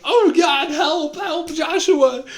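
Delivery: high, loud, frantic cry for help; panicked cry for help. Retold or imitated. Dreamer disintegrating, screaming to Joshua in panic. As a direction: shriek for your friend in terror. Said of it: "And I'm freaking out".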